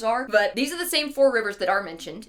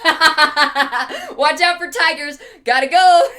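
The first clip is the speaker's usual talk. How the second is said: laughing; 6 semitones higher, 9 dB louder, much slower than usual. Real, not acted. Laughing at own joke.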